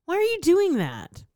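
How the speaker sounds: high, exasperated quoted rant. Retold or imitated. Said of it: "I'm like".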